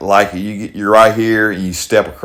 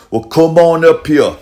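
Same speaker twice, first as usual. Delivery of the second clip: higher-pitched mock Australian accent; 7 semitones higher than usual. Grandfather quoting Arnold inviting him over, mock Australian accent. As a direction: imitate his Australian accent. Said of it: "Got the Australian voice".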